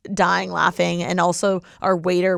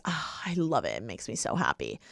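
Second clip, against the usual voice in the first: much softer, lower, sighing with bliss; blissful sigh; 6 semitones lower, 11 dB quieter than usual. Real, not acted. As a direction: sink into a hushed, contented murmur. Gushing about her happy place.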